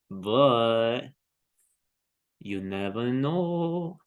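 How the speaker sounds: slow words through a yawn. Real, not acted. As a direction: talk mid-yawn, drawn out. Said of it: "Excuse my yawns".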